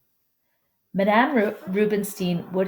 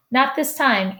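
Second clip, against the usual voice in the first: mild yell back, higher-pitched; 6 semitones higher, 3 dB louder than usual. A narrator's reading. Mom answering from her office upstairs, mild yell back. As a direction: call back lightly from afar. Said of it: "Anastasia yells back".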